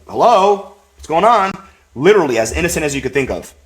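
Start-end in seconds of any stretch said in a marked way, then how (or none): 0.1-1.5 put-on high, puzzled little boy voice; little kid voice. Young grandson asking grandpa what's happening, high childish voice. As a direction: sound like a small confused child.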